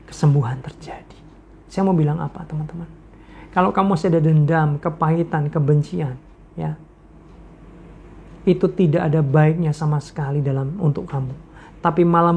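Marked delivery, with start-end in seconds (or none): none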